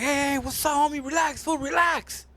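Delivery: high-pitched mock panic; alarmed pleading. Organs being beaten beg the asshole, alarmed. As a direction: plead for calm, voice pushed up high.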